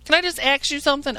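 high, whiny caller voice; high mock caller voice, put on for laughs; mocking a dumb caller. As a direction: mimic a clueless caller begging to ask. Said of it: "You know".

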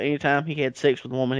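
ordinary delivery

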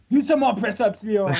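high, loud mock-godlike boss voice; David Cage megalomaniac. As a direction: bark orders like a vain tyrant. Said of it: "he seems like this sort of omnipotent".

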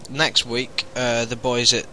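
ordinary delivery

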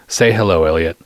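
usual speaking voice

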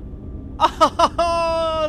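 high cackle. Real, not acted. Laughing at own pun.

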